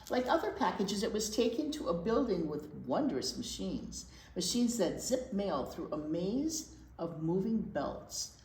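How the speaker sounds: steady storytime read-aloud voice; gentle storybook narration; picture book reading. Acted. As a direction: read it to the little ones, clearly.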